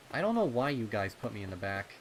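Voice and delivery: put-on loud, fast kid complaint; a nervous colt grumbling. Rumble complaining, scared.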